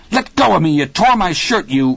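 high, loud outraged cry; angry protest. A narrator's reading. Dennis to the father who grabbed him, angry protest.

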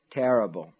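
ordinary delivery

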